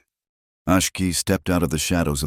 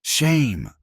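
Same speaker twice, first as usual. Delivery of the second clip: higher yelled shout; a townswoman yells at Eliza, higher; woman yelling; 9 semitones higher than usual. A narrator's reading. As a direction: shame her from the crowd. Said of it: "the woman ... yelled".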